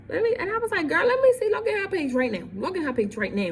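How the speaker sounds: angry friend demanding proof; heated demand. Retold or imitated. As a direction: insist hard, voice up. Confronting friend over messages.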